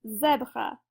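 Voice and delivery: "zèbre" with the E as in "jet"; open accented E. Shown as a demonstration. Teacher demonstrating the accent grave, "zèbre" with E as in jet. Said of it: "it's gonna sound like this".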